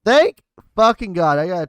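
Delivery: high, very loud outburst of relief. Real, not acted. Player relieved by a good dice roll, exclaiming loudly. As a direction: belt out the relief with full force. Relief repeated with far more force.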